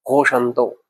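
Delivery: usual speaking voice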